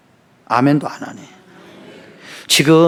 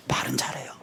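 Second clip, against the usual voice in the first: whisper; 5 semitones lower, 15 dB quieter than usual. Real, not acted. Aside about son.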